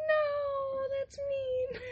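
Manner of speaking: high, whiny half-cry protest; mock whine. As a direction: whimper it like a hurt kid. Protesting the chickens joke.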